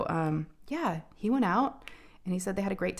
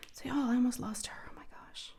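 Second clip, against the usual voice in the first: whispered confession, much softer; shaken whisper; 7 semitones higher, 5 dB quieter than usual. Real, not acted. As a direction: whisper it, still shaken by the memory. Confiding the scare to viewers.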